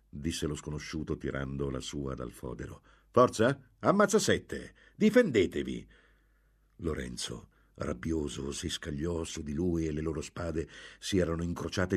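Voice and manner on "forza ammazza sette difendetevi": high, loud goading; fighting challenge. A narrator's reading. Swordsman drawing on his opponent, a loud challenge. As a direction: call him out to fight.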